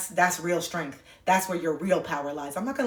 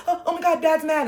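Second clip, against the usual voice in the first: high-pitched imitation of an alarmed child; anxious kid voice, much higher; worried little girl; 8 semitones higher, 3 dB louder than usual. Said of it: "My little girl would be like".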